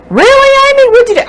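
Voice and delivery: high, loud squeaky mock scold to herself; mock self-scolding. Cook mock-scolding herself over a lost mixing bowl, squeaky. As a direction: squeal at yourself like a fussy voice.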